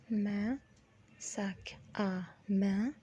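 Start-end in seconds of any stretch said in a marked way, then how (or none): 1.2-2.9 ساك آ مان whispered slowly, syllable by syllable; slow spelled-out pronunciation. Shown as a demonstration. Teacher slowly breaking down the word for handbag. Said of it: "دي بتتنطق ساك".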